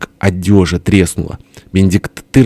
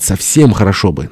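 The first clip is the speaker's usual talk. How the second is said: ordinary delivery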